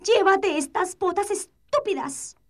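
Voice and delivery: high, loud furious shriek; cartoon princess. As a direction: scream at the wizard in a tantrum. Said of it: "chilló ella".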